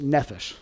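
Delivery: nephesh with the accent on its first syllable, "neph"; stress on first syllable. Shown as a demonstration. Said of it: "the accent is on the first".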